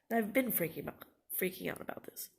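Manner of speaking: ordinary talk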